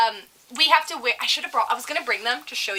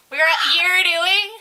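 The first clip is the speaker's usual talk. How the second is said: raised, loud imitation of the customer; mock the scolding woman; in-your-face scold; 6 semitones higher, 4 dB louder, slower than usual. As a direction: push it at the listener as a high question. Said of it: "She goes like this in my face".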